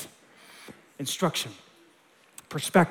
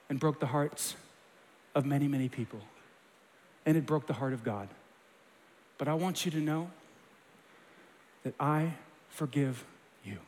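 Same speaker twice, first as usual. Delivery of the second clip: tender forgiveness; 5 semitones lower, 9 dB quieter than usual. Pretend.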